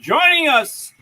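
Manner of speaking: high, loud, stretched-out announcer call; announcer intro. Acted. Host introducing a guest, big announcer voice.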